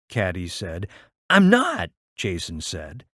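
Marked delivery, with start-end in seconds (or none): 1.3-1.9 put-on higher and louder child's denial; protesting small boy. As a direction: insist like a five-year-old. Jason protesting to Caddy.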